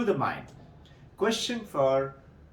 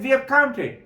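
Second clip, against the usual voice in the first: indignant protest; 9 semitones higher, 6 dB louder than usual. Acted. Man protesting to wise man.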